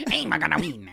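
high, loud, hammed-up Italian accent; laughing mock-Italian boast; cartoon Italian racer. Echoing the co-host's Mario Kart racer, mock Italian accent. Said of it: "playing Mario Kart".

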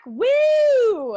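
loud, high cheer to kick off the segment; excited whoop. Real, not acted. Cohost welcomes listeners back with an excited loud whoop.

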